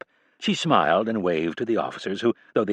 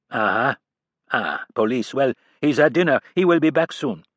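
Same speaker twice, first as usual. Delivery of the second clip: put-on cold French-accented assistant, louder and higher; thick French accent; 4 semitones higher, 3 dB louder than usual. A narrator's reading. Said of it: "in a thick French or French-Canadian accent".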